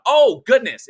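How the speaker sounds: sudden high, loud exclamation; bursting out in delighted surprise; surprised. Real, not acted. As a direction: caught off guard by a donation. Surprised by super chat.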